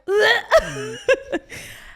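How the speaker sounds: embarrassed laugh. Real, not acted. Recalling the call.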